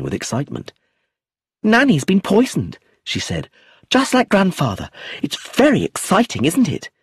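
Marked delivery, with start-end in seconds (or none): 1.6-6.9 put-on high, loud gleeful child voice; child thrilled by a murder; excited child. A narrator's reading. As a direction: delight in the gossip. Child gleeful about poisoning.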